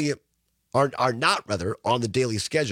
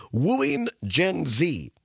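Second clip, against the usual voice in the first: slow headline read; 2 semitones higher, much slower than usual. Acted. Reading headline slowly.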